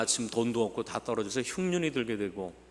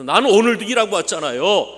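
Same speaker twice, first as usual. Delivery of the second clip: put-on much louder, higher, furious older son; resentful son shouting at his father; angry shout; 6 semitones higher, 12 dB louder than usual. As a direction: yell the grievance at his father. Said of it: "아버지가 소리를 지면서".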